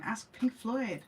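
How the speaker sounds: ordinary talk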